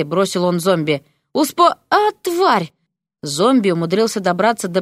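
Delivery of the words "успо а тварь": high, broken-off yelp into a curse; pained yelp. A narrator's reading. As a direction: break off mid-word, yelp and swear. Bitten teacher yelps and curses.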